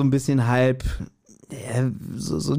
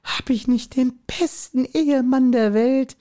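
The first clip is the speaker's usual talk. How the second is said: much higher, slow gushing falsetto; wife praising her husband in slow hammed-up falsetto; doting wife; 11 semitones higher, slower than usual. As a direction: swoon over the best husband. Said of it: "Und sie auch".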